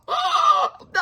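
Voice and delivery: high mock cry of "Oh, no"; replaying her shock at the twist; retold shock. Telling viewers how she reacted while listening, high retold gasp. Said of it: "I was like".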